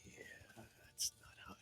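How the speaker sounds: very soft, unvoiced-sounding whisper; whispered disgust. Real, not acted. Reacting to friend's gross joke, whispered aside. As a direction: whisper it under your breath.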